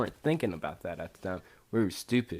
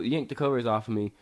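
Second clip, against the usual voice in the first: ordinary delivery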